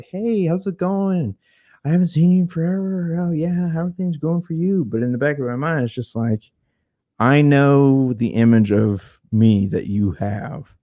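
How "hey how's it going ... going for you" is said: fake nice greeting. Bumping into ex-roommate at grocery store, fake friendly. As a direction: sing out a forced cheery hello. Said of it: "I know I could be nice".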